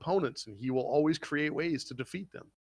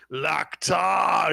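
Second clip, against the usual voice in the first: loud, high ogre bellow; ogre roar; 5 semitones higher, 8 dB louder than usual. Retold or imitated. Ogre bellowing at Goblin Slayer, loud roar. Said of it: "the ogre is like".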